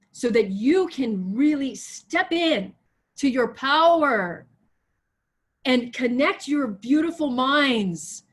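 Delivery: slow, stretched, emphatic sermon delivery; stirring preacher's exhortation; rousing preaching. Real, not acted. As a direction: charge the congregation, drawing out key words. Charges the congregation, preaching.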